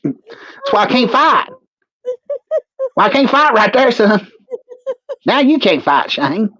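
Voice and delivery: a put-on hillbilly voice recounting the attack; character voice. Character voice again.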